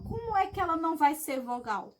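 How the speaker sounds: ordinary delivery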